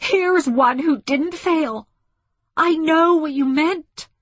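high, loud, impassioned shout; screaming defiance. A narrator's reading. Woman shaking a fist at the ship, screaming. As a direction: hurl it up at them. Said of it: "she screamed".

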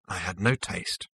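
slow, soft sulky remark; sullen. A narrator's reading. Zaphod gives up before falling silent, sullen and slow. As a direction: drop it quietly, sulking. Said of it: "said Zaphod".

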